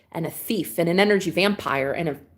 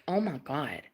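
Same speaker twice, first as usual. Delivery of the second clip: mock dejection; 4 semitones lower, 7 dB quieter, slower than usual. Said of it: "I'm sitting here like".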